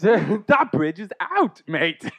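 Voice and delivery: slow, stretched mock Aussie action hero; Australian Terminator parody. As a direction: drag it out as a hammed-up Australian Terminator. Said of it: "if the Terminator was Crocodile Dundee".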